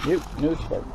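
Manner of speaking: ordinary talk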